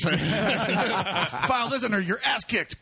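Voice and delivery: high, slow, laughing through the punchline; laughing joke. Pretend. Friend's joke about the fight, laughing high and slow. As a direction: crack up while landing the line.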